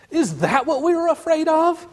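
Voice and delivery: high mocking creature voice; jeering creature. One of the rabble mocks the shorn Aslan, high sneering voice. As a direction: sneer it up high like a scornful goblin. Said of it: "said another".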